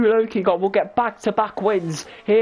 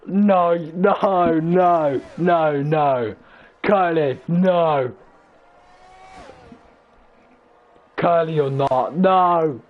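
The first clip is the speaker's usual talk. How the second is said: voice cracking; 3 semitones lower, much slower than usual. Real, not acted. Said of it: "I can't out these voice cracks".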